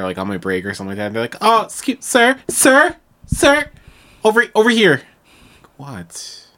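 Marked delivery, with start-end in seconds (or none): 1.4-5.0 high, loud mock call across the store. Customer loudly calling a clerk across the store, high mock call. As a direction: hail the clerk like a pushy shopper.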